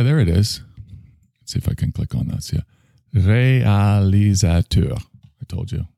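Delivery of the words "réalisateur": slow, drawn-out bad French accent. Shown as a demonstration. Host trying the French word for film director, bad French accent. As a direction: exaggerate every syllable in a poor French accent. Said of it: "the worst French accent I ever heard".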